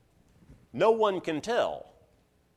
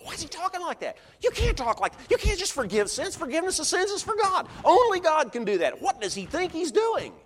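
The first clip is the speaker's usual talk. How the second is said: high-pitched, mock-outraged protest; indignant teachers' thoughts; 3 dB louder than usual. Law teachers thinking Jesus blasphemes, indignant. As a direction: act out scandalized objectors, pitch jumping way up. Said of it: "but they're thinking to themselves".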